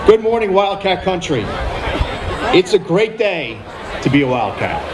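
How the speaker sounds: high, upbeat rally greeting; podium speech. Real, not acted. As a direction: pump up the home crowd. Opening greeting to the crowd.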